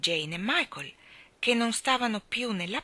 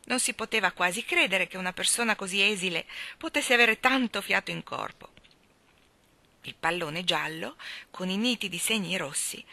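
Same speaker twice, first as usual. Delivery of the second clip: ordinary delivery, nothing marked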